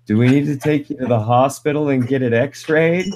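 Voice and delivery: a hint of concerned mother; mom's voice. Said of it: "and she goes".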